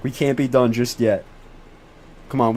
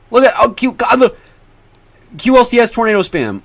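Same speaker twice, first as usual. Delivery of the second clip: excited; 8 semitones higher, 5 dB louder than usual. Real, not acted. Streamer thrilled by tornado on radar.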